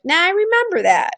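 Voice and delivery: high, breezy "nah, I remember that" brush-off; dismissive self-quote. Retold or imitated. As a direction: toss it off lightly like you don't need the book. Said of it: "'cause I've been like".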